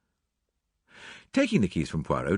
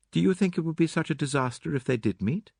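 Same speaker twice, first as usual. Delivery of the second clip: lightly raised, cool female character voice; Mary Cavendish; 8 semitones higher than usual. Acted. Mary asks Hastings about the meeting, high composed question. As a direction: question him in a higher register.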